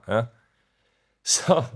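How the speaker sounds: ordinary talk, nothing marked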